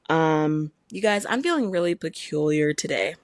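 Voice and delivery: ordinary talk, nothing marked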